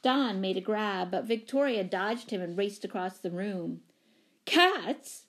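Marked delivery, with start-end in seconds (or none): 4.5-5.2 high-pitched horrified exclamation. A narrator's reading. Missus Pennypacker spotting a cat in her room, horrified. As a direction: exclaim it in horror. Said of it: "exclaimed Missus Pennypacker in a horrified voice".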